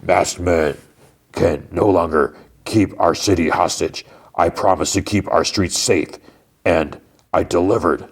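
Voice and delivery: measured press-conference proclamation; Fisk. Retold or imitated. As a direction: deliver it like a politician's speech, in portioned phrases. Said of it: "Then Fisk is, like, doing".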